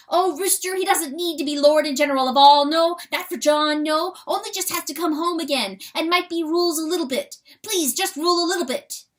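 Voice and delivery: high, loud, fast, begging; pleading weasel voice. Acted. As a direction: beg him to come home.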